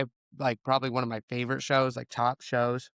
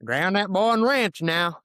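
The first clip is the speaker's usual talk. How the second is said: higher, slower hillbilly drawl, lightly done; hillbilly accent; 6 semitones higher, 5 dB louder than usual. Retold or imitated. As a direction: play up a hillbilly accent, a little louder. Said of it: "the most hillbilly thing you've said".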